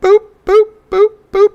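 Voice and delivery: high squeaky beep noises; scanner beeps. Retold or imitated. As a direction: chirp like a checkout scanner. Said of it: "Lackluster checkout".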